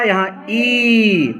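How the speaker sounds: ई stretched to twice the length; long vowel demo. Shown as a demonstration. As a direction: draw out ई long and slow. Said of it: "यहाँ ई बोला".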